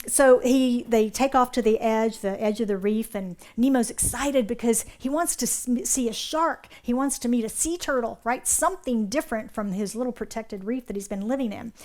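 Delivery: ordinary talk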